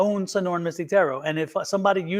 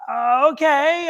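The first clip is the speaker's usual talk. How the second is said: high, drawn-out hesitant reply; reluctant agreement; 7 semitones higher, 4 dB louder, slower than usual. Retold or imitated. Her giving in to Spirit about the call, reluctant. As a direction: act out giving in, unsure.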